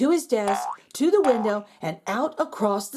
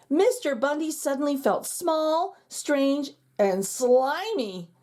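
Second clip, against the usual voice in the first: ordinary delivery, nothing marked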